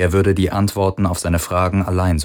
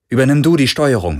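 fast, high, loud command; urgent order; 6 semitones higher, 6 dB louder, faster than usual. A narrator's reading. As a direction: take charge in a hurry. Pilot gives urgent orders. (hastiger, lauter Befehl)

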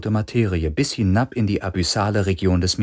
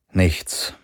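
ordinary delivery, nothing marked

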